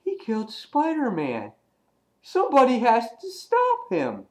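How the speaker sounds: high-pitched mock alarm; hammed-up panicked crowd voice; shocked bystanders. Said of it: "the people were in shock".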